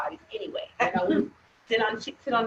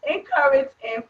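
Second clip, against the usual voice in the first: laughing while talking; 5 semitones higher, 5 dB louder than usual. Real, not acted. Laughing through words.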